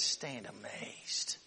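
slow, soft whisper; awed whisper. Retold or imitated. Moses whispering to Israel to stand amazed. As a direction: breathe it in wonder. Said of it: "He says".

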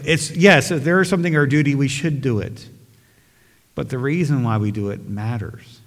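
ordinary talk, nothing marked

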